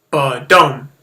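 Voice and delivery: loud heartbeat sound, short then strong; heartbeat ba-dum. Shown as a demonstration. Teacher showing the iamb as a heartbeat, loud thump. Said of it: "like the beat of a heart".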